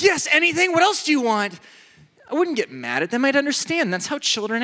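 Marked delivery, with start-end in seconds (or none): 0.0-1.6 high, loud, eager to please; eager doting. Retold or imitated. Doting relative answers a thirsty niece, eager and warm. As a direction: fuss over the child happily.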